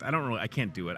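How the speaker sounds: normal talking voice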